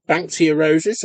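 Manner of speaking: ordinary delivery, nothing marked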